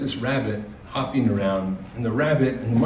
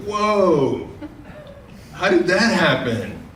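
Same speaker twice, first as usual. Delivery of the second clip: higher, slow, laughing whoa of disbelief; amazed monkey; 7 semitones higher, much slower than usual. Acted. Monkey watching the zebra vanish, amazed and laughing.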